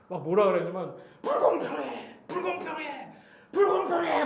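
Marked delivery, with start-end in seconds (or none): 1.2-4.2 high, drawn-out mock cry of protest; retold shouting. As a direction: imitate yelling at the sky, held back in volume. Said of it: "소리 지르면서". (외치는 흉내를 내는 높은 목소리)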